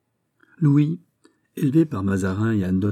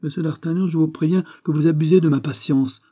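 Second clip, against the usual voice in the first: high-pitched, louder royal warning; angry warning; 7 semitones higher, 5 dB louder than usual. A narrator's reading. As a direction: warn him that your patience is ending. King warns he is losing patience.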